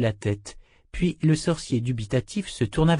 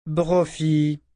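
higher scolding call of a name; réprimande; 6 semitones higher than usual. A narrator's reading. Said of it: "le tança Callan". (ton de réprimande)

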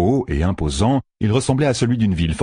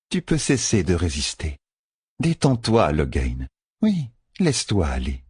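a convert calming her captive with false tenderness; cold soothing Tovein. Acted. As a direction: lull him into giving in.

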